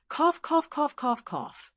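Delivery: coughing fit, fading out at the end; fake coughing. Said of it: "started coughing violently".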